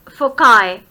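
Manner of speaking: foci in British English, said FOH-kye with a hard k; British pronunciation. Shown as a demonstration. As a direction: foci spoken the British way, FOH-kye ending in a k-sound and "eye". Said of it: "In British English, we pronounce its plural as".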